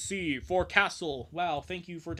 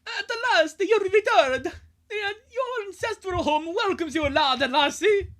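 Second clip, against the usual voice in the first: much higher, loud, slow Scottish welcome; 10 dB louder, slower than usual. Acted. Scottish steward Angus welcomes the kids home in Scottish accent. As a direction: boom it out like a Scottish castle keeper. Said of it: "do I do the Scottish voice".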